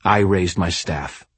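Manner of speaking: usual speaking voice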